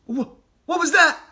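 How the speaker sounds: sudden high, loud alarm; stammering fright; startled. Acted. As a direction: break off at a sound. Fox startled by a noise.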